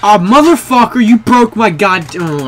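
normal talking voice

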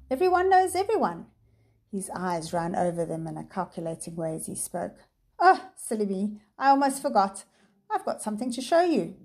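polite words with menace beneath; politely menacing. Acted. Professor voice.

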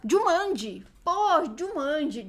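thrilled recognition, second word stretched; excited fan. Real, not acted. Spots a beloved childhood film.

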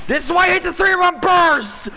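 loud angry gamer outburst; frustrated yell. Real, not acted. Rage at the game.

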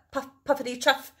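usual speaking voice

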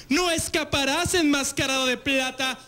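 hammed-up high female villain voice; mock a lady villain from old wrestling films; lightly voiced campy villainess. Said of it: "qué les queda a las mujeres villanas".

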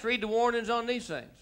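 normal talking voice

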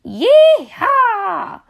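high loud whoop; cowboy yeehaw. Acted. Dancing states cheering at a hoedown, high loud whoop.

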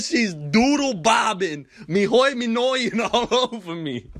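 slow, cracking up mid-line; laughing through it. Real, not acted. Repeating his rap and breaking into laughter. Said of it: "I said".

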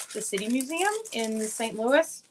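usual speaking voice

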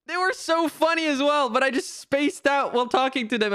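high, regretful whine; whiny lament. Real, not acted. Streamer mourns losing a funny team, whiny lament.

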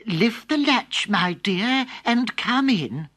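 a frail grandmother welcoming; slow granny voice; old grandmother. Acted. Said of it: "Grandmother called out".

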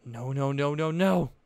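growing panic; panicked. A narrator's reading. Weiss dreads the offer.

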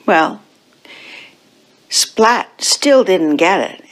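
plain reading voice

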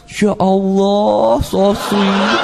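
slow, drawn-out gushing; swooning nurse. Retold or imitated. Nurse reacts to the lone patient's answer, mock swooning. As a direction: stretch it out like a touched nurse. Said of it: "Langsung susternya bilang".

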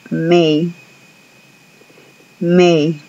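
May as a clear glide; model pronunciation. Shown as a demonstration. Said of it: "pongan atención a la pronunciación".

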